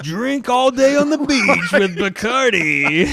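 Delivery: loud, high, drawn-out party announcer; mock liquor ad. Host spoofing a clashing liquor spot, exuberant mock ad read. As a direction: blare out a spoof beach-drinking commercial.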